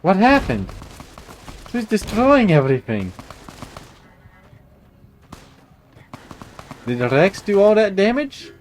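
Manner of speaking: high, loud shocked questions; surprised. Real, not acted. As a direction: gasp it out in disbelief. Player finds destruction, shocked.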